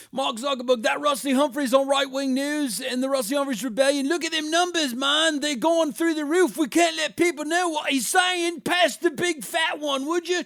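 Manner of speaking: very high, loud, sing-song cartoon villain voice; a stoned schemer crowing over the numbers; George Soros impression. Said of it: "That's my George Soros impression".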